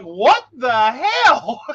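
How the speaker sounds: high, loud and drawn-out disbelief; mock bewilderment. Sitting there five seconds after the remark, baffled. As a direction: draw out each word, hammed-up and baffled. Retold reaction seconds later.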